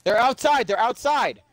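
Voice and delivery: high, alarmed cry of panic; panicked high voice. Real, not acted. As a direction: shriek it in real alarm. Panics as enemies approach.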